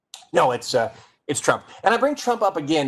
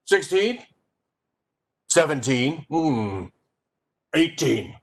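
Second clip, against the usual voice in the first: pompous; slower than usual. Shown as a demonstration. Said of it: "So be pompous and, uh, over important".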